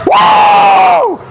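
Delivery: huge high whoop after the lift; victory whoop. Real, not acted. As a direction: yell it out in triumph. Lift completed, cheering.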